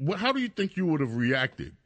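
normal talking voice

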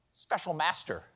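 ordinary talk, nothing marked

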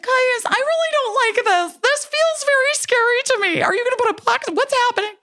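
squeaky high, panicky voice; hammed-up scared squeal; mock terrified. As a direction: play up the fear like a game-show contestant. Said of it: "I felt like I was on Fear Factor and I was terrified".

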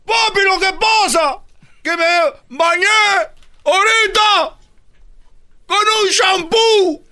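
very high, halting comic yell; screamed excuse. Acted. Boy explaining the shampoo to his dad, halting scream. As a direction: scream it out in separate stretched chunks. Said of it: "por eso estoy gritando".

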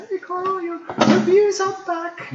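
put-on high, drawn-out Dutch accent. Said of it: "talking to me in a Dutch accent".

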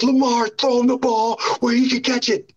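high, pleading complaint; exasperated plea to the QB. Retold or imitated. Pleading with Lamar from practice, high exasperated complaint. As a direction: beg the quarterback from the sideline. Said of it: "I'm like".

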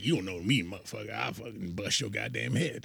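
soft old bayou man impression; Bayou Dan. Brother's bayou character threatening a stranger, old-man grumble. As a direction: threaten under the breath like an old bayou local. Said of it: "that's Bayou Dan right there".

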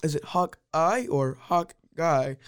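slow, deliberate imitation of Dad; mocking dad's delivery. Kid teasing Dad about the way he talks, mocking slow imitation. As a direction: mimic him word by word, dragging it out. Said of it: "just the way you're talking, you're like".

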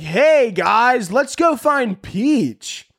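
high, loud cheery rallying call; hammed-up eager shout to the gang; mock chipper Mario. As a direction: overdo the peppy hero, stretching the last words. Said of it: "He can't be like".

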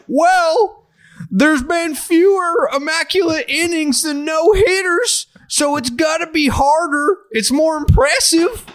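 high, exaggerated square-dance twang accent. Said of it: "what kind of accent that was".

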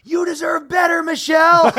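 high, loud yell from the crowd; heckler's yell. Retold or imitated. Heckler in the crowd yelling at the mayor, loud and high. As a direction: shout it across the stadium. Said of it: "One guy goes".

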